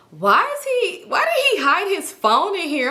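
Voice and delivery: high, outraged disbelief; shocked question. Retold or imitated. Girl finding the man's hidden phone, high and shocked. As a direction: catch him hiding something.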